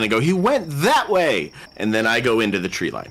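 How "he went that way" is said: high, slow mock yell; acted shout. Player repeating the misdirection to the guards, high mock shout. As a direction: send the pitch way up and stretch it like a call. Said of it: "and I shout".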